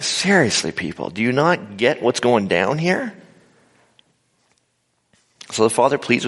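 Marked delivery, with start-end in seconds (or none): 0.0-3.1 exasperated Jesus. Acted. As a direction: drag out your disbelief at the crowd. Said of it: "Jesus is kinda like".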